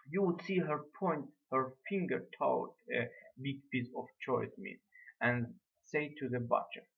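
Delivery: ordinary delivery, nothing marked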